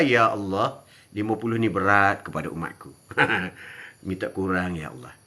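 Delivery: low, soft, humble plea; pleading prayer. Acted. Prophet pleading with Allah to lessen the prayers, low and humble. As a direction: beg gently, voice sinking.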